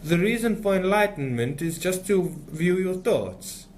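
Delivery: imitated guru with a dodgy French accent; mock French accent.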